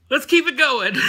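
loud, high show-opener call; hyped-up intro. Pretend. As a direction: kick off the segment with a big, high burst. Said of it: "thought that'd be a cool intro".

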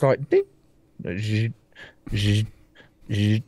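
put-on a "ding" then low buzzing "zzzz" like an old phone line; mock dial tone buzz; imitated phone ring. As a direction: buzz like a crackly landline. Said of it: "He just picks up this".

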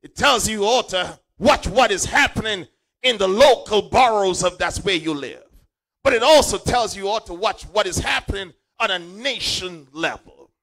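high, loud, half-sung preacher cadence; preaching at full force. Acted. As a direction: whoop it up to the congregation.

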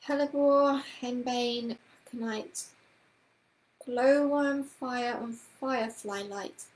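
slow chanted list of herbs; spell incantation. A narrator's reading. Miss Price chanting her spell over the bed knob, incantation. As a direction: intone each ingredient like a spell.

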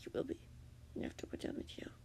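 hushed, whispered threat; ominous. A narrator's reading. The captor threatens Grian, ominously. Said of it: "the man said ominously".

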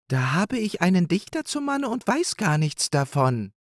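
lightly voiced hare wife, a touch of amazement; surprised wife hare. Wife learning her husband is a poet, lightly amazed.

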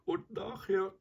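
high, plaintive lament; self-pitying whine. A narrator's reading. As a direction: complain pitifully, pitched well up. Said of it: "klagte er weiter".

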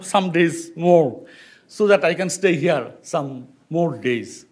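usual speaking voice

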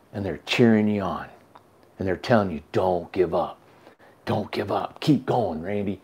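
soft, low, hushed urging; hushed, earnest pep talk; retold quiet encouragement. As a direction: imitate her gently urging a friend onward. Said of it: "she was going like that".

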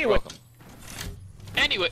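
high mocking echo of a teammate's brushoff; mocking imitation. Mimicking a teammate who always mutes mid-sentence, high and mocking. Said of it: "He always does that".

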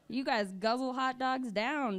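ordinary talk, nothing marked

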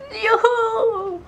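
high, loud whoop of joy; joyful whoop. Real, not acted. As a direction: cheer it out, thrilled. Celebrating finished editing.

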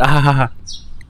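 trailing awkward "ahaha"; softening stilted chuckle; awkward laugh. Acted. Player covers an awkward moment with the girl, awkward laugh.

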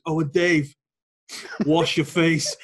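loud mock-posh English order; prim posh English command, pushed loud. Teacher orders laughing pupil out, mock posh English. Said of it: "very, very posh".

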